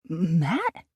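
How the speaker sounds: can't believe it's him; incredulous. A narrator's reading. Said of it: "I asked incredulously".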